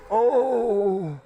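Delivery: high, long exaggerated moan; gasp, then groan long and high; mock overheated groan. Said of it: "they're gonna be sweating".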